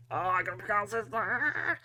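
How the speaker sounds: high, strained mock wail; hammed-up anguish, pitched right up; mock agony. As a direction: groan the choice out as if it hurts. Mock agony over a hard pick.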